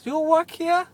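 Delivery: high, loud mock German accent; imitated German accent. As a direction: call it out in an exaggerated German accent. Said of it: "stereotypical German accent".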